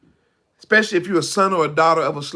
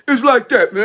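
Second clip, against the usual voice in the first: loud, high mock griping; back to loud; 3 semitones higher, 6 dB louder than usual. Acting out someone complaining among friends, loud again. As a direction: pop back up to animated volume. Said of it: "you be loud".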